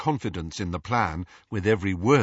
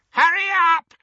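shrill, much higher, loud screeching shout; a pocket watch screeching at the wizards to hurry; 9 dB louder than usual. A narrator's reading. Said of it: "a loud voice screeched".

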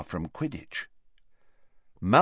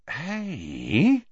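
high, drawn-out questioning hey; puzzled, rising exclamation; sudden realization; 7 semitones higher than usual. A narrator's reading. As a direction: break off with a startled query. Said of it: "he said suddenly".

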